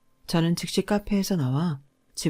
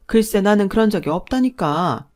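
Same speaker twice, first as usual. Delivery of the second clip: loud, higher, aggrieved mother-in-law; angry denial; 4 semitones higher, 7 dB louder than usual. A narrator's reading. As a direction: lash out as if wronged. Mother-in-law keeps denying angrily. (억울하다며 화내는 시어머니)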